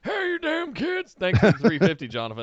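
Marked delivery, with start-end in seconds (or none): 0.0-1.2 put-on grumpy old man.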